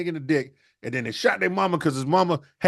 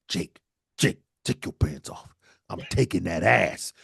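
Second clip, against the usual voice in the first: much lower, slow menacing voice; menacing dad; 8 semitones lower, slower than usual. Acted. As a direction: drop low and slow, leering. Dad answering his son.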